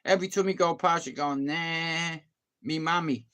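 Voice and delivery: normal talking voice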